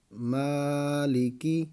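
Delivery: مالکی read very slowly, the ما stretched one alif long; slow spelled-out مالکی with a long ما and short لی کی; joined slow reading. Shown as a demonstration. Teacher reads the joined word slowly for the class, stretching ما. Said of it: "اس کو ایک الف کی مقدار کھینچ کر پڑھنا ہے".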